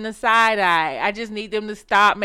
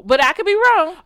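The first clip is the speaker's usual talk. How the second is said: self-hedge; 9 semitones higher, 7 dB louder than usual. Real, not acted.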